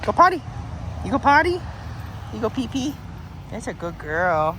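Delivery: high, sing-song "go potty" coaxing. Real, not acted. Talking to the dog.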